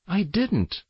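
put-on the hurt girl starts to object; protesting. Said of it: "Julia began to protest".

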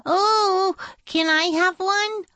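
put-on very high little child voice; eager pupil. Joy asking teacher for a paperclip, eager. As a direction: pipe up, pleading high.

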